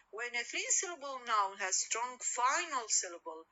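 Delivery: ordinary talk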